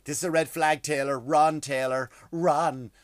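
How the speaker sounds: loud mock fangirl warning; hammed-up panicked fan pleading; mocking Swifties. Fans online mocked as shrill fangirls, loud mock alarm. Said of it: "they're like".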